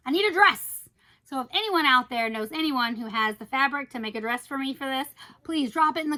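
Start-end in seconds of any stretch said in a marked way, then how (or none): 0.1-0.8 higher, louder insistence; playful, loud plea; emphatic demand. Real, not acted. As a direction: burst out with urgent wanting. Repeats wish, emphatically louder.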